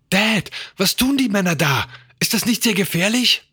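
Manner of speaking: high-pitched, anxious questions; worried child. A narrator's reading. Son asking Dad about the men, worried. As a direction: ask anxiously in a high young voice. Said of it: "James beobachtete besorgt die Männer".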